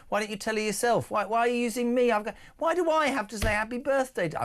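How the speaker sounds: high-pitched joking protest; mock indignation. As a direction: pretend to protest, voice climbing. Mock complaint, then "I'm joking.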